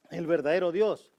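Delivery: loud, high, fervent preaching; full-force preaching. Acted. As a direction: drive it home from the pulpit, loud and high. Preaching burst.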